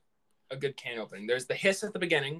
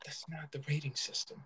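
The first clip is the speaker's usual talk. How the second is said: whispered correction, much softer; whispering; 10 dB quieter than usual. Real, not acted. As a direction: whisper it under your breath. Whispered aside.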